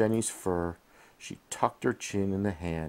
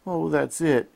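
moaning; 9 semitones higher, 7 dB louder than usual. A narrator's reading. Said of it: "she moaned".

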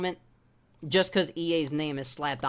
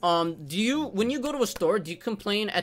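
lightly voiced condescending agent, a bit higher; mocking the support agent; 4 semitones higher, 4 dB louder than usual. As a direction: mimic her patronizing tone. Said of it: "she's like".

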